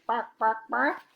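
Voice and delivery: high, squawky chicken noises; put-on chicken squawk; chicken clucking. A narrator's reading. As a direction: cluck it like a hen. Imitates chicken clucks in story.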